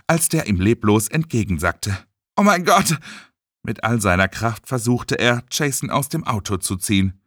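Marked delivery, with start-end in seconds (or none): 2.4-3.0 high-pitched shock; horrified exclamation. Acted. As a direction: gasp it out in horror. Findet den Freund leblos. (entsetzter Ausruf)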